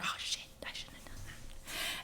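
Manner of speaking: breathy hushed oh-no, caught out; regretful whisper. Retold or imitated. Speaker acting her own regret after losing her temper, whispered. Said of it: "I flare and then I'm like".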